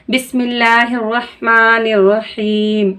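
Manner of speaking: slow, measured Quranic recitation. Shown as a demonstration. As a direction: intone it slowly and reverently. Recites before the lesson.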